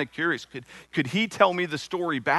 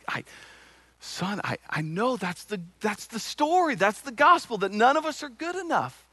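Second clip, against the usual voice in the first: higher and pleading, son I know; urgent reassurance; 9 semitones higher than usual. Retold or imitated. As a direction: push hard to get through to him. Father urgently reassures crying son.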